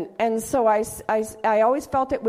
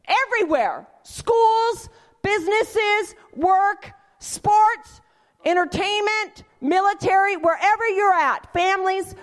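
fervent listing; 8 semitones higher, 3 dB louder, slower than usual. Real, not acted. Passionate list in sermon.

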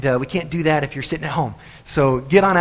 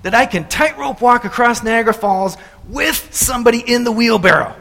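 loud, high showman's challenge; daredevil addressing crowd; 8 semitones higher, 5 dB louder than usual. Acted. Daredevil with buddy in the wheelbarrow, loud showman's call. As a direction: build up the biggest dare yet. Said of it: "And the guy says".

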